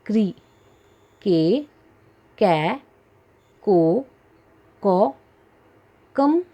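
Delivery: का, कि, की, कु, कू, के, कै, को, कौ each said slowly and clearly with pauses; teacher sounding out क syllables for children; barahkhadi recitation. Shown as a demonstration. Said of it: "तो सुना आपने". (बच्चों के लिए धीरे-धीरे बारहखड़ी बोलना)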